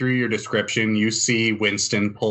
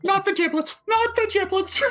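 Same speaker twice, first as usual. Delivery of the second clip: very high falsetto cartoon plea; Disney character. Game master voices a cartoon character being killed, falsetto panic. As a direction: squeal it like a panicking cartoon critter. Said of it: "you hear a Disney character".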